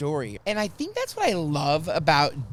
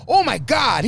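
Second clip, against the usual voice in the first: loud, high retold exclamation; going crazy; 5 semitones higher, 8 dB louder than usual. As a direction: act out a thrilled fan's outburst. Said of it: "he was, like, going crazy".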